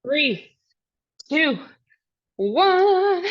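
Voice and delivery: loud final count; calling out. Real, not acted. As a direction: shout the last number.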